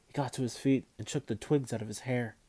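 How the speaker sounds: usual speaking voice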